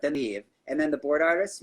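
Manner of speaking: ordinary talk, nothing marked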